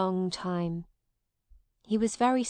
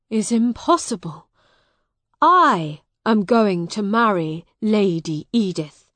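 loud, insistent objection; indignant protest; 9 dB louder than usual. Acted. Miles disputes Hugh's marriage to Edith, indignant. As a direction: insist on your claim.

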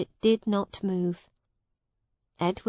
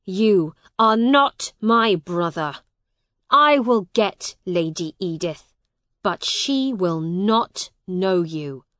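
loud, hard denial; angry shouting; 8 dB louder than usual. A narrator's reading. Hugh disowns the man claiming to be his brother, angry. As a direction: disown him forcefully. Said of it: "shouted Hugh".

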